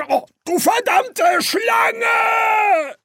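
very high, slow, drawn-out shriek; furious demon curse. A narrator's reading. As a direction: scream the curse in rage. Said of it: "Eine kehlige Stimme erklang".

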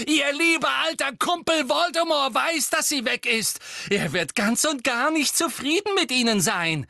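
high, loud mocking call; taunting shout. A narrator's reading. Harry goading Bellatrix about Voldemort, shouting. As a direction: jeer it across the hall.

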